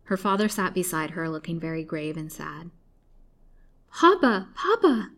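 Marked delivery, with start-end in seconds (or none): 3.9-5.1 high, loud, passionate cry, falling on each word; choked cry. A narrator's reading. Lily calling to her father at the sight of him, choked cry. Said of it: "cried Lily in a choking voice".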